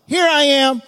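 loud, high proclamation; hammed-up answer; mock Abraham. Abraham answering his son, loud and hammed-up. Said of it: "He's like".

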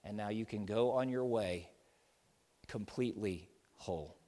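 low, hushed and gentle; soft assurance. Acted. Jesus softly blesses the healed woman, hushed and tender. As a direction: speak peace over her quietly. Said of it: "Jesus says".

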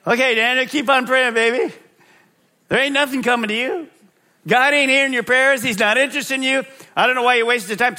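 high, fast taunting voice; Satan tempting. Retold or imitated. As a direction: tempt him in a mocking, high voice. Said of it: "What do you think Satan said to Daniel?".

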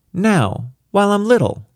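put-on high, bossy little girl; a small child giving orders; commanding child voice. A narrator's reading. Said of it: "she commanded with a frown".

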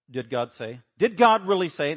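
rising to a loud doubting sneer; mocking tempter. Preacher voicing Satan questioning God, sneering. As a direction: plant doubt like the serpent. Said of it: "That comes from Satan".

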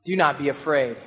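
gentle reassurance. Retold or imitated. Jesus calming frightened disciples.

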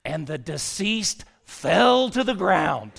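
slow, loud Southern drawl with a big punch on "fell"; imitated Southern storyteller booming out the climax; Jerry. Funeral director reaching the climax of his casket story, loud Southern accent. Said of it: "in a sweet and Southern, you know, gentlemanly way".

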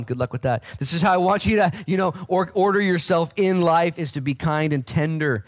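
fervent preaching. Acted. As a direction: preach it out with conviction. Raised preaching of scripture.

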